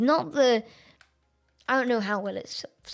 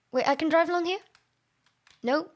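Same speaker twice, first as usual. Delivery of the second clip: normal talking voice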